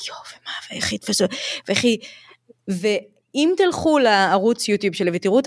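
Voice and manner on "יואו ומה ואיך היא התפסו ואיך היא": quick whispered self-doubt; imitated inner panic, barely voiced; retold anxious whisper. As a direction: whisper it fast, like fretting to yourself. Retelling her worried reaction to mistakes.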